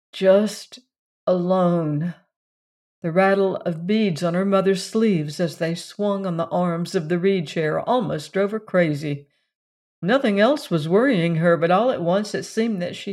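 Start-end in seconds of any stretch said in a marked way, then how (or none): none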